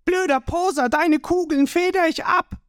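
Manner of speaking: high, cheeky, cocky voice; Ruffy. A narrator's reading. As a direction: taunt him high and carefree.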